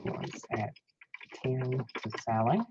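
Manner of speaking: hushed, breathy whisper. Real, not acted. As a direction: whisper it off to the side. Quiet bid call to auctioneer.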